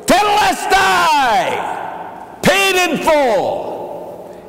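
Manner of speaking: high, slow ringing cry; slow, lifted proclamation; loud cry. Acted. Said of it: "He said it in a loud voice".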